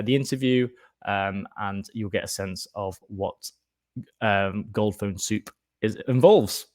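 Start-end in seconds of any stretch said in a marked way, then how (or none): none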